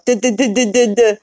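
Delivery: high, dumb-sounding duh sounds; mocking duh. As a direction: play the troll as an idiot.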